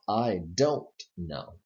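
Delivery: don't fully sounded and set apart from know; stress on don't. Shown as a demonstration. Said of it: "when you want to be emphatic".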